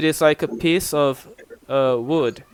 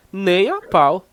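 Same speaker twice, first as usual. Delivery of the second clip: higher-pitched, offended refusal; offended intonation; 5 semitones higher, 4 dB louder than usual. Shown as a demonstration. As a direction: refuse as if the request offends you. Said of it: "offensive intonation".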